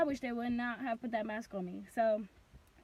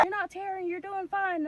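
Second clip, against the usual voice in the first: higher-pitched hurried reassurance; lightly voiced doctor; 7 semitones higher, 3 dB louder than usual. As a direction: imitate a doctor soothing a panicked patient. Doctor reassuring panicking patient, retold.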